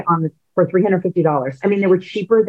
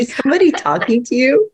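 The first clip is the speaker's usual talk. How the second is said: high sing-song pet talk; coaxing the parrot gently; 7 semitones higher than usual. Real, not acted. Owner coaxing parrot on camera.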